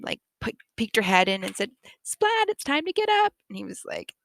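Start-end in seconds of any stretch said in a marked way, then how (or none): 2.0-3.3 put-on high-pitched mom calling her child awake; high singsong wake-up voice; mom's wake-up call. Mom voice waking Splat.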